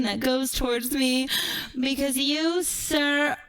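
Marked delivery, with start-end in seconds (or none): none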